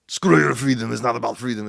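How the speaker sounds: lightly mimicked Austrian accent; a touch of mock Austrian accent; Arnold's Austrian accent. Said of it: "for him to come out there".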